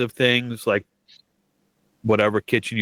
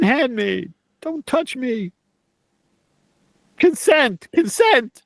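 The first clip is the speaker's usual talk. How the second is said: fluttering cries for help; 11 semitones higher than usual. Acted.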